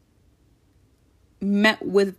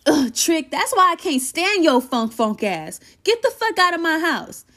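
playful annoyed friend; 7 semitones higher than usual. Acted. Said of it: "Palace says".

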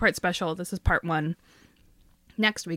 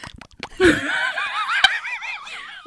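high shrieking laugh. Real, not acted. Laughing fit after whisper.

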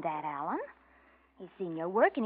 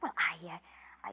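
whisper; 5 semitones lower, 3 dB quieter than usual. Acted. Caught out, hesitating.

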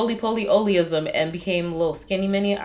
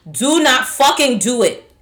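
"Do not fucking do it" louder, higher and slower; emphatic warning; 6 semitones higher, 10 dB louder than usual. Real, not acted. Warning anyone who calls them patat, emphatic and loud. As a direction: hit each word as a loud final warning.

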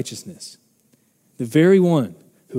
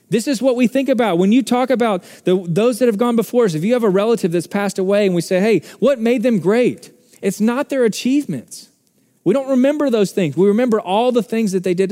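preaching; 7 semitones higher, 3 dB louder than usual. Acted. Preaching raised again.

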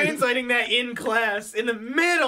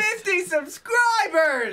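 excited cheer; 9 semitones higher than usual. Real, not acted. Celebrating milestone.

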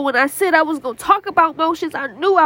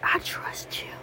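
breathy whisper of trust; barely voiced, said only to God; 14 dB quieter, slower than usual. Shown as a demonstration. Repeating the prayer softly.

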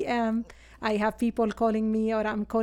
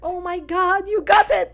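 real delight that the guess was right; excited surprise; 9 semitones higher, 10 dB louder than usual. Real, not acted. Guest cries out when the host guesses Syria, excited. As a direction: burst out, higher and louder, delighted.

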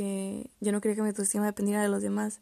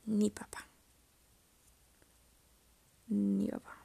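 whispered resignation; 3 dB quieter than usual. Real, not acted. Giving up on the search.

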